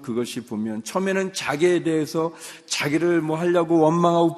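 rising fervent preacher voice; impassioned preaching. Acted. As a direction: drive the point home from the pulpit. Preacher builds to full force.

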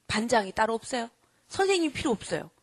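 normal talking voice

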